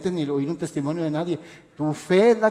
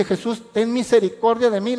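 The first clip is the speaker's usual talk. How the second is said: raised, higher-pitched plea, acted out; louder, higher mock cry to Jesus; retold cry for mercy; 5 semitones higher, 5 dB louder than usual. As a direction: imitate a woman crying out for mercy. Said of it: "la primera vez que le grita a Jesús".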